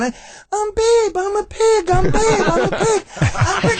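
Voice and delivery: high, squeaky little pig voice; Babe pig voice. Retold or imitated. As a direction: chant it like a coked-up piglet rehearsing. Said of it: "trying to get the voice down".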